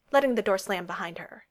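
ordinary delivery

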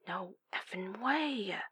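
very soft, drawn-out disbelief; strangled shock. A narrator's reading. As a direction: stretch each word slowly in a hushed, stunned voice. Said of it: "Her voice was low, strangled by the shock".

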